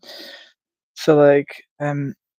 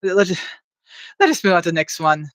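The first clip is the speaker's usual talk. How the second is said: normal talking voice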